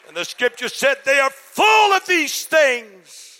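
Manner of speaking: loud, high-pitched preacher's shout; fiery sermon climax; preaching at full force. Real, not acted. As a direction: build it to a pulpit roar.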